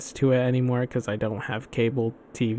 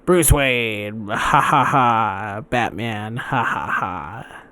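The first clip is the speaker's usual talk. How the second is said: cartoon villain with mock laughter, falling away; Joker; 2 semitones lower, slower than usual. Fan imitating an actor's villain taunting Batman, mock cackling. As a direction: imitate a smoky-voiced villain, laughing between names.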